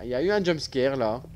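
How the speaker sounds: ordinary talk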